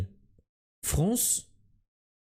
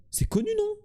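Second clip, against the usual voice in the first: incredulous question. Real, not acted. Incredulous about famous friterie.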